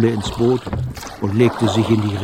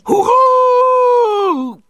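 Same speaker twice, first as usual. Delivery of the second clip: long, very high, loud cheer; bawled cheer; 7 dB louder than usual. A narrator's reading. Pirates cheer at the squeal, bawling. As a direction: bawl it out like a whole pirate crew. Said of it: "grölten die Piraten".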